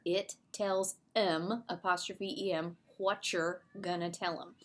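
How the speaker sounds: slow Southern drawl on It tells 'em. Shown as a demonstration. Teacher showing the class the Southern saying, in a Southern drawl. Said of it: "write down all of the dialogue, uh, the dialect there".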